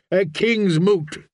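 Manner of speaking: KING'S MOOT declared slowly, with a falling close; solemn proclamation. Acted. Priest proclaims the summons.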